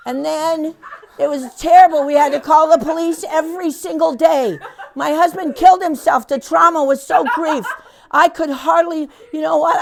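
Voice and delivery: loud mock drama breaking into laughter; hammed-up tragic tale. Volunteer spinning an absurd sob story to the mom, loud and laughing. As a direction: overplay the misery while cracking up.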